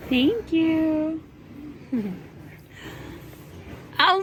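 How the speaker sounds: usual speaking voice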